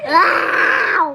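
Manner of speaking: loud crying wow; shouted wow. Real, not acted. Reaction to spicy food.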